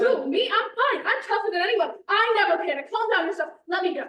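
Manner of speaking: indignant, pitch jumping up; agitated protest. Acted. Actress fending off helpers at customs, agitated protest.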